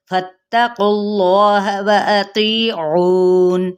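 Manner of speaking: slow, drawn-out melodic recitation of the verse; Quran recitation. Acted. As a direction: chant each word slowly with full tajweed. (धीमी सुरीली तिलावत)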